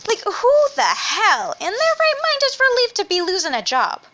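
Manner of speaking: high, loud indignant rant; incredulous outrage at being fired; outraged. Real, not acted. Outraged rant about firing.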